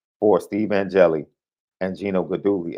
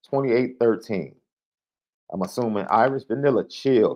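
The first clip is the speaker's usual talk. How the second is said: ordinary talk, nothing marked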